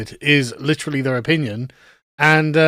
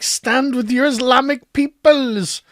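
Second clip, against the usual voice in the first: higher, slow sarcastic parody of his plea; mocking the activist; 7 semitones higher, slower than usual. Mocking the Welsh activist's plea to the border guards, higher and slow. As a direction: stretch it out in a mock-earnest voice. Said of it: "He's like".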